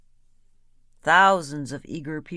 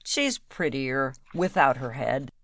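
smiling; 4 semitones lower than usual. A narrator's reading. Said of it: "He smiled and said".

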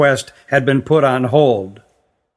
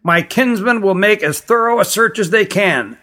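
higher-pitched voice, held taut; queen's tense promise, pitched up; 6 semitones higher than usual. A narrator's reading. Queen assuring the visitor of a thorough search, tensely. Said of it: "said Lenara tensely".

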